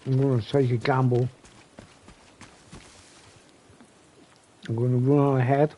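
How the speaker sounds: chewing while talking; mouth full. Real, not acted. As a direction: talk through a mouthful. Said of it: "Sorry for the chewing noises".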